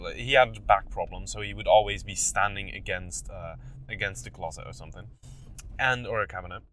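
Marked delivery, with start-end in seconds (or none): none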